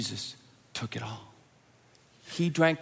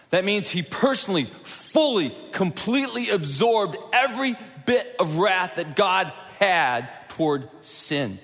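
higher, louder preacher's cry over music; preaching at full force; 7 semitones higher, 4 dB louder than usual. Acted. Preacher rebuking the congregation over sin, rising to full preaching force. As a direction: drive it home from the pulpit.

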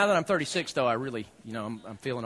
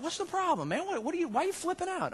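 imitating mom; 11 semitones higher, 4 dB quieter than usual. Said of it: "My mom's like".

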